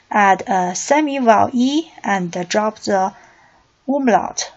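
ordinary talk, nothing marked

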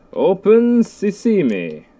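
slow, high, drawn-out magic command; theatrical incantation. Pretend. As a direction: stretch it out like a grand spell. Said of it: "Don't know why I said it like that".

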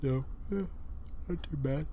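talking through a yawn; words stretched by a yawn. Real, not acted. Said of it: "Sorry again for the yawning".

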